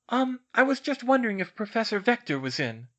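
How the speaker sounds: hesitant schoolgirl. Acted. Schoolgirl asks a portrait for her professor, politely hesitant. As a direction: ask politely in a lifted young voice.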